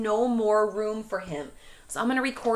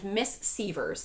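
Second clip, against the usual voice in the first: ordinary talk, nothing marked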